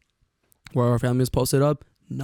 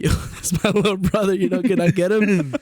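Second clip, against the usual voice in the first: laughing retold plea; 5 semitones higher than usual. Laughing through retold plea to lifeguard.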